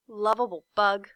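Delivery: usual speaking voice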